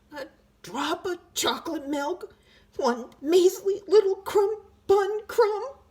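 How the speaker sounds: high, wheedling little voice; high pleading tooth voice. A narrator's reading. Tooth begging the boy for sweets, high and wheedling. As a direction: beg sweetly for a crumb.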